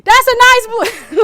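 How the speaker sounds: very high, very loud shout; mock shout at a confused listener. Shown as a demonstration. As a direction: yell it as if they're deaf. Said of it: "we're supposed to get louder".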